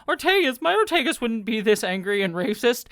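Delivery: high, loud outburst trailing into a pleading complaint; exasperated fan protest. Retold or imitated. Fan protesting to a beloved character, in mock exasperation. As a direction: cry out in mock dismay at a favourite character. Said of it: "I'm like".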